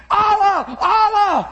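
high-pitched mock cries of panicked kids; imitated screaming. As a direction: cry out like a kid in shock, acted. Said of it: "He should be screaming".

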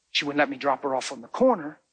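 ordinary talk

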